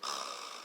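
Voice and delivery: soft French R gargled in the throat; dry gargle. Shown as a demonstration. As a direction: gargle quietly without any water. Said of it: "just practice gargling water".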